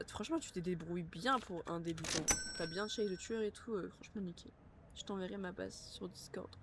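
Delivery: ordinary talk, nothing marked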